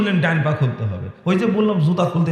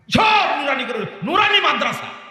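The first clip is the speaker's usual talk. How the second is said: high-pitched indignant cry; angry outburst; 10 semitones higher than usual. Real, not acted. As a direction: burst out in exasperation, pitch way up. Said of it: "রাগ বেশি হয়ে গেছে". (রাগে চড়া গলায় চিৎকার)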